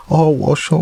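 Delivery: put-on baby voice. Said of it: "in my little baby voice".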